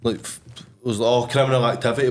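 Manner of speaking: ordinary talk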